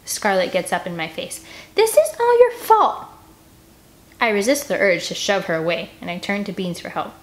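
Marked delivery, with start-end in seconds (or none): 1.8-3.1 high, loud, slow accusation; furious accusation. Acted. Scarlet up in Annie's face, furious high accusation. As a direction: spit the blame at her.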